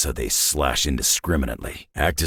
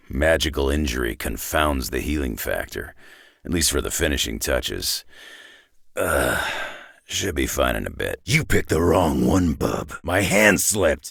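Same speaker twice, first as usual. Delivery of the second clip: ordinary delivery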